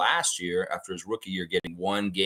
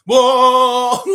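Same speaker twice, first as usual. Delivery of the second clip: high, loud laughing shriek; hammed-up scream with a laugh; mock horror scream; 10 dB louder than usual. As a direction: fake a horror-movie scream for fun.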